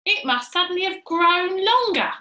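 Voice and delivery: high, loud, astonished character voice; alarmed amazement. Acted. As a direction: exclaim it as a startling discovery. Mrs Twit alarmed at her stick.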